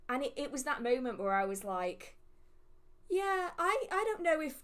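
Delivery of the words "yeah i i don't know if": wavering admission. Retold or imitated. Admitting tarot isn't for her.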